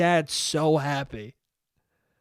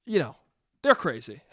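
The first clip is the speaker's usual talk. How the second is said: normal talking voice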